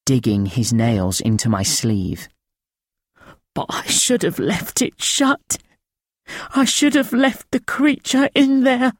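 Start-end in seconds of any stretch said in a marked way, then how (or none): 3.5-8.9 higher, thin rasping voice of a haunted man; thin rasping old voice. A narrator's reading. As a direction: rasp it out thin and frightened, pitched up. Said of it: "His voice a thin, rasping trickle".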